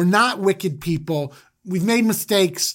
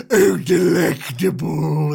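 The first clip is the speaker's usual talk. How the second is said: creepy delight; 3 dB louder, slower than usual. Retold or imitated. Joseph savoring Benjamin, slow creepy relish. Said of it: "He's like".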